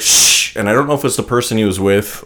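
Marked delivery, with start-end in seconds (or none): 0.0-0.6 sharp unvoiced "shh"; shushing. Moviegoer hushing a noisy man, shushing. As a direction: imitate someone shushing a talker. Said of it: "Someone said".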